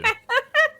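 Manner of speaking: squeaky gremlin giggle. Acted. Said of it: "That's a really good gremlin impression".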